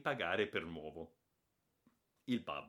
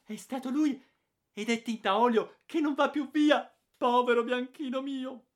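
much higher, louder, lamenting; wailing aunt; 12 dB louder than usual. A narrator's reading. Aunt Bettina lamenting to the father about the painted dog, shrill wail. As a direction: wail over the ruined dog.

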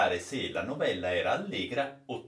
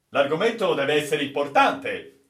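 louder, eager captain's exclamation; exclaiming captain; 4 semitones higher, 7 dB louder than usual. Acted. The captain eagerly guesses about the new story, exclaiming. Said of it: "esclamò il capitano".

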